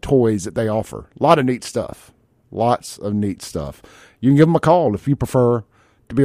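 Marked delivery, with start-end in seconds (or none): 2.5-3.8 slow, softer, lower delivery; slow emphatic repeat. Real, not acted. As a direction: let each word sink in, slowly.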